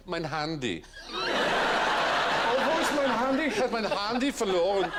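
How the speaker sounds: high, laughing camp German voice. Pretend. Camping up German for the chat show host. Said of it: "It's just so typically camp".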